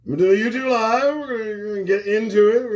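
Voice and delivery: hyped-up announcer's call; loud, fast announcement. Shown as a demonstration. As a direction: announce it to the whole audience with a punch. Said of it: "Somebody said to make that announcement".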